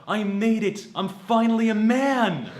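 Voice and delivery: loud, high victory cry, slowing at the end; mock triumph. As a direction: proclaim your manhood to the whole room. Acted triumph of his younger self.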